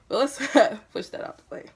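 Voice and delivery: loud and high, laughing through it. Real, not acted. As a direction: laugh the line off. Laughing off a family aside.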